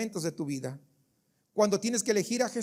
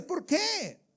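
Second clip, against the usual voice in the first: Pilate asks; 7 semitones higher than usual. Acted. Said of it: "Pilato dice".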